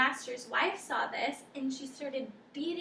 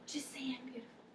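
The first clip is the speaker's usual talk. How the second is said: softer, whispered insistence; insistent whisper; 8 dB quieter, faster than usual. Acted. Dog pressing the cat for a compliment, whispered. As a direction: whisper the demand, pressing hard.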